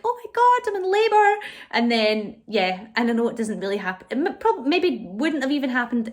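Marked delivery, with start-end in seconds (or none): 0.0-1.4 high, loud pretend burst of excitement; excited. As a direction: squeal it like the waters just broke. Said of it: "you're like".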